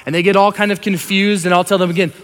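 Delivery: normal talking voice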